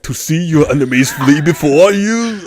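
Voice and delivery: a touch of barbarian boast; Conan quote. Pretend. Answering a joke question in a mock Conan voice. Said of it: "Trump, what are the best things in life?".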